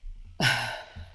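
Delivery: soft unvoiced sigh, low; somber sigh. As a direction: let out a heavy breath first. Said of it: "it's a chuckle that kinda leads into".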